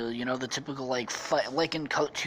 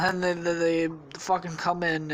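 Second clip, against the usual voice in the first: ordinary delivery, nothing marked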